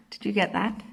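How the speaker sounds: ordinary delivery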